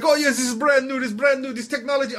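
high, eager mock-Israeli accent; pushy Israeli hawker impression; Israeli salesman pitch. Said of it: "he starts going into his whole thing".

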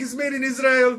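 high mock-Israeli accent; Israeli salesman. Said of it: "there was some Israeli dude".

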